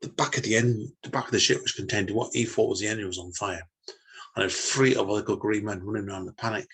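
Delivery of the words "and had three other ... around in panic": usual speaking voice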